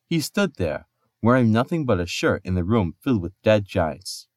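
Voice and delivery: plain reading voice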